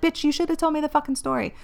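a lightly voiced viewer demanding the story; mock annoyed viewer. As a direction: toss it off as a pushy fan's complaint. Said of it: "message me and be like".

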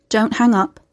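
smooth, understated pleading; smooth plea. A narrator's reading. Familiar caller begs Carrie to stay on the line, smooth plea. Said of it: "The low, smooth plea was horribly familiar".

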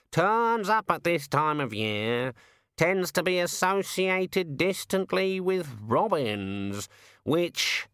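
slow, high squawking bird voice; drawn-out, high bird delivery; explaining raven. Acted. Raven's held voice.

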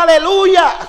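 high, loud praise cry; shouted hallelujah. Real, not acted. Preacher tells the church someone still shouts praise, rousing shout. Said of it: "alguien allí que grita".

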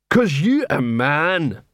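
very slow troll voice; drawn-out troll character. A narrator's reading. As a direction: explain it ponderously as a simple troll. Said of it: "Since he was a troll".

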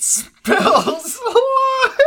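words dissolving into high laughter; can barely get the last word out for laughing. Real, not acted. Cracking up while reading post.

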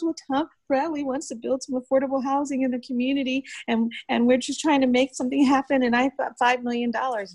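high-pitched, helpless explaining; imitated flustered official. Rookie official explains the housing money to her, high flustered imitation. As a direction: plead his case in a high voice.

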